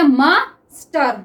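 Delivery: drawn-out word, syllable by syllable; dictating slowly to the class; slow dictation. Shown as a demonstration. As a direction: stretch it out while writing. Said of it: "अब क्या गया?".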